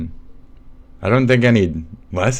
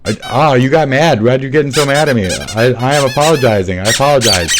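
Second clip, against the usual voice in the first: higher, loud, aggrieved apology; loud protesting apology; 4 semitones higher, 8 dB louder than usual. Acted. Apologizing to someone who got mad, loud and protesting. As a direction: raise your voice and protest that you're apologizing.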